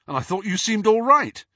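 high, loud teasing; mock dismay. A narrator's reading. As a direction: protest in exaggerated boyish disbelief. James teasingly reacts in dismay.

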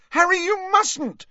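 high-pitched alarmed shriek; frantic, high plea to stop. A narrator's reading. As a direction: shriek it in horror. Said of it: "shrieked Hermione".

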